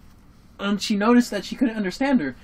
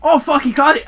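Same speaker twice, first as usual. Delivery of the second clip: ordinary talk, nothing marked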